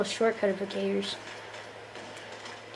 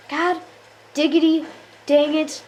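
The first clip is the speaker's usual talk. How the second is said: ordinary delivery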